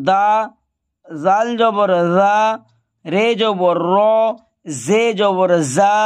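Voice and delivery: slow, spelled-out drill, each syllable ending on a clear vowel; zabar drill recitation. Shown as a demonstration. Teacher slowly reciting the zabar drill for learners. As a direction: chant each letter with zabar slowly for students to copy. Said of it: "দেখেন এইভাবে পড়তে হবে".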